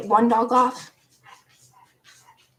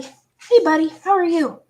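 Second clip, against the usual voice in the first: lightly acted call to a dog; loud, higher greeting to a dog; retold friendly greeting; 6 semitones higher, 7 dB louder than usual. Calling out to the dog.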